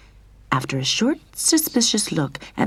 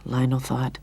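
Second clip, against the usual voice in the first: plain reading voice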